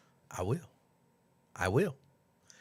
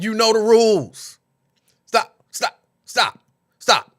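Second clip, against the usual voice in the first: louder, higher mock scolding; imitating frustrated moderator; 6 semitones higher, 8 dB louder than usual. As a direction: play the strict moderator shouting stop. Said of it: "She was like".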